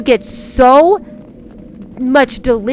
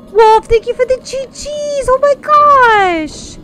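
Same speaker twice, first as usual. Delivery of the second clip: high, drawn-out squeal of thanks; high-pitched gushing delight; 11 semitones higher than usual. Real, not acted. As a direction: squeal it, overwhelmed by generosity. Gushes thanks for gifted subs.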